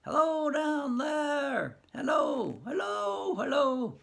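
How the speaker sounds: high calls, dragged out slow; shouting down a well. Acted. Harley shouting into the well for his wife, high and drawn out. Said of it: "leans over and shouts as follows".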